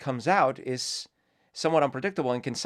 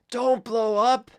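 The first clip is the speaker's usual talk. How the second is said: yelling at a volcano; 10 semitones higher, 7 dB louder than usual. Pretend. Vlogger mockingly yells up at a volcano, high and loud. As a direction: shout at the volcano like a scolding parent. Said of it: "yelling at a volcano".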